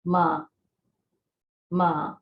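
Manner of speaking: ma with the pitch gliding up; rising tone ma. Shown as a demonstration. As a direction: lift ma upward like a question. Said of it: "your tone is going up".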